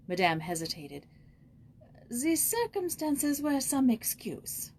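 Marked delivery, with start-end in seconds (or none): 2.1-4.7 slow, higher, hesitant concession; admitting it with reluctance; reluctant admission. Acted. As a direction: give ground grudgingly, slowly. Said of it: "she finally admitted".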